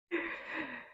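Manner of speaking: high breathy witch cackle. Pretend. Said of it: "Do you have a better witch laugh".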